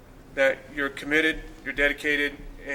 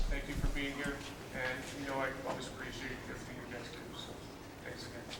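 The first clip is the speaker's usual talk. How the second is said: ordinary talk, nothing marked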